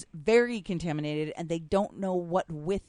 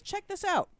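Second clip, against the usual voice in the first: "Check this out" read in a higher pitch; high pitched sign voice; 12 semitones higher than usual. Retold or imitated. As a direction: mimic a cheery store sign. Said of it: "a big sign that says".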